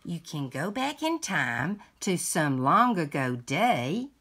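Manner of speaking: lively picture-book read-aloud; reading to children. Acted. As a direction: read it like a bedtime story to a grandchild.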